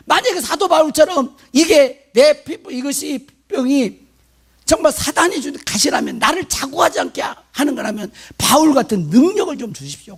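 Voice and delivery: acted-out high, insistent plea; complaining prayer. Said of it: "원망 불평을 쏟아놓고 기도를 하더라고요". (따지듯 높은 목소리로 간구하는 재연)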